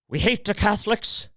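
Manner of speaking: imitating great-grandmother in a poor Irish accent; bad Irish accent. Great-grandmother's hateful saying in a bad Irish accent. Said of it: "Can't do a very good Irish accent".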